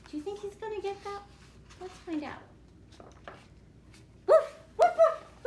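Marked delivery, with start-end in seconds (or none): 4.3-5.4 high, loud, yappy barking; big puppy bark. Acted. Puppy barking at the pigeon, loud and yappy.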